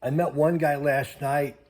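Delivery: ordinary talk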